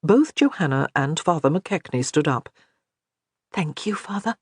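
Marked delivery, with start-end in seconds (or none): 3.5-4.4 whispered. A narrator's reading. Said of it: "she whispered".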